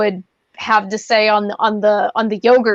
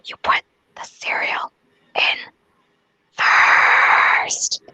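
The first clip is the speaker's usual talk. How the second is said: whisper; 3 dB quieter than usual. Real, not acted. Confessing petty judgment.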